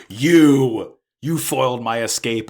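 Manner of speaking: loud, drawn-out accusing opener, then easing off; mock accusation. Miorine sees Suletta and confronts her, acted accusingly. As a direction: imitate an indignant girl spotting the culprit. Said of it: "sees her and she's like".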